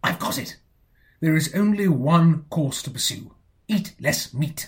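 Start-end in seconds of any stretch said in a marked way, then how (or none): 3.7-4.7 Eat less meat, each word slow and weighty; slow, weighty pronouncement. Acted. Nephew solemnly advising his aunt, slow weighty pronouncement. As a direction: lay it down slowly like great wisdom. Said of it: "must you drivel".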